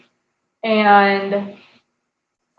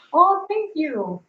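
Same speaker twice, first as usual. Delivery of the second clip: touched thanks; 8 semitones higher, slower than usual. Real, not acted. Touched by compliment from viewer.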